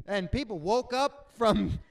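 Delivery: normal talking voice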